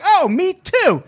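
very high, loud imitated delight; mock excitement. Man flirting with a waitress, high mock-excited shout. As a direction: squeal with put-on surprise.

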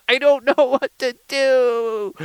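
high, slow mock-desperate wail; hammed-up helpless whine; trying not to cry. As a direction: wail it, stretching the last word. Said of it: "trying not to cry".

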